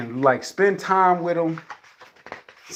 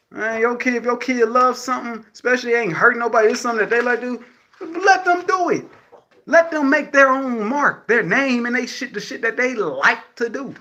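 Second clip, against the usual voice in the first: higher, heated pleading; passionate plea; 8 semitones higher than usual. Real, not acted. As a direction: pour your heart into it, pushing.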